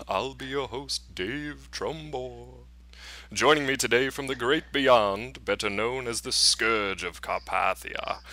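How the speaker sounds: hammed-up spooky horror host with drawn-out words. Podcast host opening a Halloween edition, spooky voice. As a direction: ham it up as a ghoulish host, stretching names slowly. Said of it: "put my spooky voice back on".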